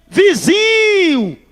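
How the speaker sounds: high, long stretched greeting shout; cheerful drawn-out call. Retold or imitated. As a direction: call out to a neighbour, dragging the word. Pastor chama alegre de longe. (pastor cumprimenta o vizinho feiticeiro: chamado alegre e bem esticado)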